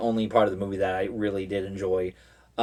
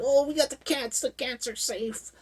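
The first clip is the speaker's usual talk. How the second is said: much higher, lightly voiced relief; mock relief; 14 semitones higher than usual. Friends rescuing the cats, high mock relief. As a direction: squeak out the relief. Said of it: "they're like".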